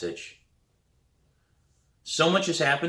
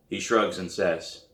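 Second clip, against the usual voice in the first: usual speaking voice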